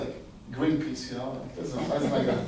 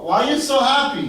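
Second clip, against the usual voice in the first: higher, louder puzzled king; acted-out astonished question; 7 semitones higher, 6 dB louder than usual. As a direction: ask in loud amazement. King questions a cheerful beggar.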